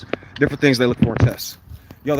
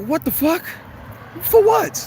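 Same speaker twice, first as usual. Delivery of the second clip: shocked outburst; slower than usual. Retold or imitated. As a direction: blurt it out high in disbelief. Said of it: "I was like".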